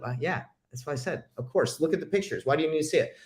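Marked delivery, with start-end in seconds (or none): none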